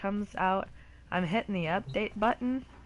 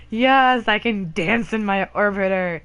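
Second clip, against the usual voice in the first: loud, thrilled outburst; excited shouting; 3 semitones higher, 11 dB louder than usual. Real, not acted. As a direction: cheer it out with joy. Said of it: "I'm so excited".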